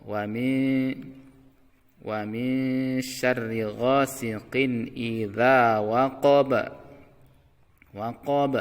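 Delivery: slow recitation, waqab ending on a bounced b; bounced final b. Shown as a demonstration. Teacher reciting slowly with a bouncing final b. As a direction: let the b of waqab echo. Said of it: "qalqalahnya qalqalah wusta".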